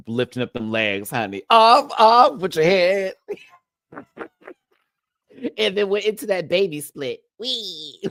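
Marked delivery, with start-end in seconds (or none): 1.5-3.1 much higher and louder, slow queenly command; imitating Uma. Doing Uma's lip sync for viewers, hammed-up regal decree. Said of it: "Am I gonna do a little bit of Uma's performance". 3.9-4.5 squealing laugh. Real, not acted. Laughs at own impression. 5.6-8.0 mock cutesy split. Retelling Uma's split.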